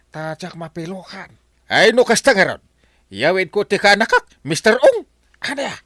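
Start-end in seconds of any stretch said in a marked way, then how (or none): 1.7-5.7 loud, raised angry confrontation; angry demand. Acted. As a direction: lay into him loudly. Captain confronts Mister Ong over contract.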